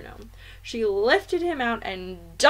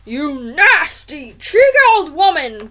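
loud, slower blustering shout; angry shout; 5 semitones higher, 13 dB louder than usual. A narrator's reading. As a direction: bellow the insult, drawing out the first words. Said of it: "they shouted".

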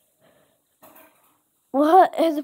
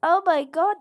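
ordinary talk, nothing marked

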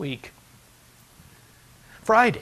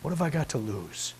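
ordinary talk

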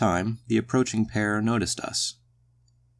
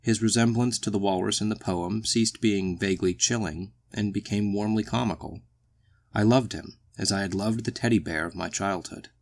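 usual speaking voice

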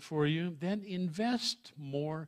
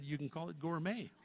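ordinary talk

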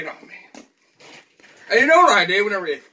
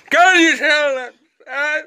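loud, slow, high mock wail of sadness; overdone pretend sadness, slow and loud; hammed-up wail; 7 semitones higher, 4 dB louder than usual. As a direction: whine it out like a fake sob.

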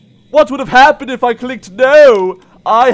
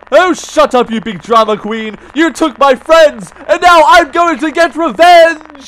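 raised-pitch scolding building to a vow; defiant retort; 4 semitones higher, 4 dB louder than usual. Real, not acted. Player tells villain friends will be avenged, defiant retort. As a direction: throw the threat back, building louder.

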